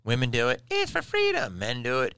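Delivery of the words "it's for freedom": very high squeaky-pitched cheer; mock rallying cry. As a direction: send up the cause in falsetto.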